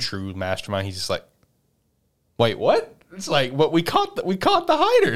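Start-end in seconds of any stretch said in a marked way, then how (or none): 2.4-5.1 high-pitched, climbing mock bewilderment; imitated disbelief. Player who caught the hider learns he lost, mock disbelief. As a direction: protest in confusion, voice jumping up. Acting out losers' stunned reaction.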